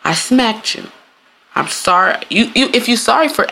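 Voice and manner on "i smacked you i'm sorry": slow, spelling out each part; slow deliberate apology. Shown as a demonstration. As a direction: lay it out slowly. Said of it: "you would say".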